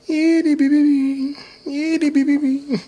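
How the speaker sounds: high, loud and slow, drawing out the name; mock high answer. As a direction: answer like a sing-song character. Pretend reply, high and loud.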